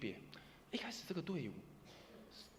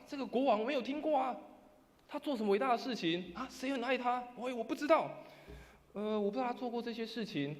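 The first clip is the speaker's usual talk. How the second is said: raised voice, higher and louder; somewhat louder; 8 semitones higher, 4 dB louder than usual. Retold or imitated. Person inside the church answering in a raised voice. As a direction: call out from inside, a bit louder. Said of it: "稍微大声的声音说什么".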